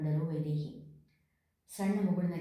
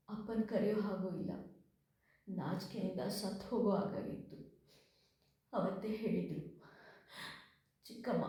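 tearful confession; 3 semitones higher than usual. A narrator's reading. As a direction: hold back tears while telling the shame. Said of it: "ಬಿಕ್ಕಿ ಬಿಕ್ಕಿ ಅಳಲಾರಂಭಿಸಿದಳು". (ಅಳು ತುಂಬಿದ ದನಿಯಲ್ಲಿ)

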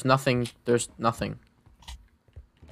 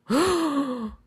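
sharp startled gasp. Real, not acted. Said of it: "almost gave me a freaking heart attack".

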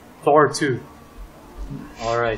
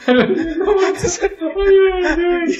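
high, loud, squealing through laughter; real helpless laughter; 7 dB louder than usual. Real, not acted. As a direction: barely get it out laughing. Cracks up at mocked accent.

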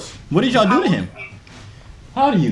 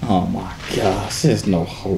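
very low, slow and soft; deep voice despair; 13 semitones lower, 6 dB quieter, slower than usual. Pretend. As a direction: sink into hopeless deep tones.

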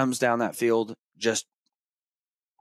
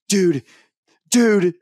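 much higher and louder, thrilled yell; excited shout; 9 semitones higher, 9 dB louder than usual. Retold or imitated. Boy sprinting to his first buck, thrilled yells. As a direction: shout it running toward the deer.

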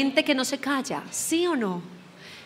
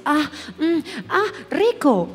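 mock movie-style moan; typical movie moan; 3 semitones higher, slower than usual.

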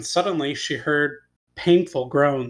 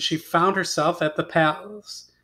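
ordinary delivery

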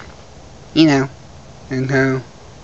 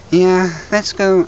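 ordinary talk

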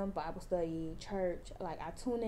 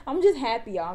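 happy outburst; 3 semitones higher, 10 dB louder than usual. Real, not acted. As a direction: blurt out your happiness loudly. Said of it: "acting a little wild".

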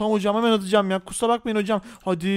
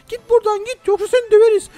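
high, louder threat; threatening bully; 10 semitones higher, 5 dB louder than usual. Pretend. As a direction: warn him off angrily.

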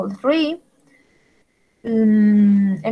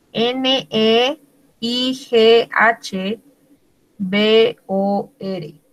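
slow spelling; much slower than usual. Shown as a demonstration. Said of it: "spelling out neighbor".

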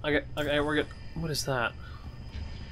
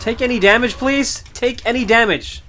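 loud, higher pleading; exasperated pleading; 6 semitones higher, 9 dB louder than usual. Real, not acted. Gamer begs the enemy to take damage, exasperated. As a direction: beg in frustration.

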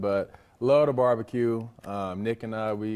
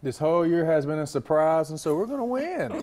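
excited; 5 semitones higher, 5 dB louder than usual. Real, not acted. Former player predicts a win.

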